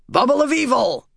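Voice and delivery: high-pitched alarmed call. A narrator's reading. Harnan to those nearby, calling out. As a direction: cry a warning across the field. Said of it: "Harnan called from nearby".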